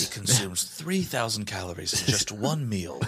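slow, hushed nature-film narration; mock documentary narrator. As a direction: narrate softly and slowly, as if observing wildlife. Mock nature documentary about modern humans.

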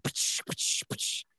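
whispered puffs imitating air blasts; air cannon bursts. Imitating the cave's air cannons, soft unvoiced puffs. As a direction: puff out the air cannon sounds breathily. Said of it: "air cannon bursts at you, like".

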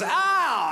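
high, drawn-out imitated ow; mock pained cry. Everyone in the office reacting to the clang, mock cry. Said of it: "Everyone in the office goes".

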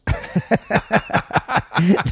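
high burst of laughter; laughing. Real, not acted. Laughing after a joke.

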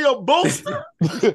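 loud, high, drawn-out question; incredulous echo. Retold or imitated. Stoned man repeating Kenny's explanation back, incredulous echo. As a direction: stretch the word out in disbelief.

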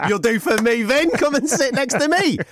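high, slow, coaxing "come sit here"; hammed-up chummy invitation, pitched high; mock beckoning voice. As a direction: playfully mimic someone summoning a mate over. Voicing a man inviting him over.

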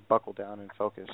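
normal talking voice

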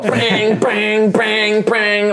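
high ringing mimicry of hammer blows; imitated clanging. GM mimics the noisy workshop, high imitated clanging. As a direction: bang out each word like struck metal.

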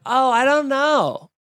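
high, slow airy shrug of a voice; hammed-up clueless flight attendant; mock clueless attendant. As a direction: play the attendant as breezy and unbothered.

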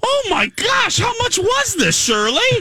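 very high shocked outcry; shocked shriek. Retold or imitated. Retelling the bill at the register, a high shocked shriek. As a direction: shriek it like the price just hit you.

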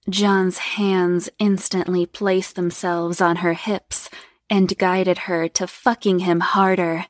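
usual speaking voice

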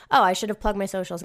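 ordinary talk

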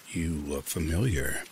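slow, low, scratchy stranger; creepy stranger drawling the words. A narrator's reading. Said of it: "his voice low and scratchy".

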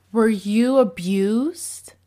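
slow, shocked mock voice of the boyfriend; slow exaggerated imitation of a shocked boyfriend; imitating the boyfriend. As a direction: ask it slow and aghast, as if scandalized.

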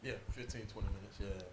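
faint and distant, off mic; speaking away from the microphone; host tells guest he's off mic, faint and distant; too far from the mic. Real, not acted. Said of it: "You gotta talk into the microphone".

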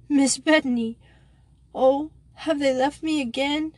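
put-on high, tearful cry of a lost girl; sobbing plea. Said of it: "a sobbing voice said".

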